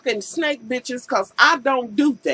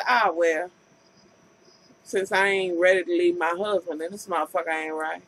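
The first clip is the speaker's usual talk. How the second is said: lower, quieter wife voice as it dawns on her; wife's realization; 8 semitones lower, 4 dB quieter than usual. Retold or imitated. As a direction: let it sink in, muttering to herself. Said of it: "she realized".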